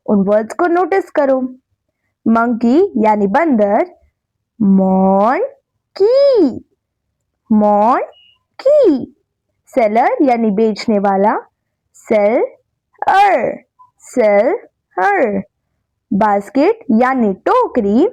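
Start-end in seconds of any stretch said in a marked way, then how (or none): 4.6-9.1 monkey slowly split as मॉन, pause, की; two separate syllables. Shown as a demonstration. Teacher showing children two syllables, monkey slowly broken in two. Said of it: "Some words have two syllable". 12.0-15.4 seller slowly split as सेल, pause, अर; two separate syllables. Shown as a demonstration. Teacher has children repeat after her, seller slowly broken in two. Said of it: "Some words have two syllable".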